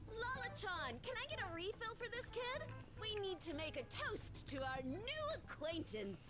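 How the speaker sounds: high, bright call across the bar; cheerful calling out. Acted. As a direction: call out to the bartender with glee. Calling bartender for a toast.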